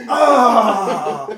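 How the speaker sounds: loud mock moan, laughing; hammed-up climax cry. Taunting a tailgater, a loud hammed-up moan.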